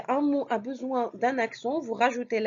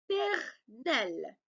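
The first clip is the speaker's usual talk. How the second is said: "paternel" very slow, split into pa-ter-nel; syllable by syllable; 2 semitones lower, 4 dB quieter, much slower than usual. Shown as a demonstration. Teacher cutting a word to find the accents, slow syllables. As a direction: break "paternel" into three separate syllables. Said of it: "il faut couper le mot par syllabe".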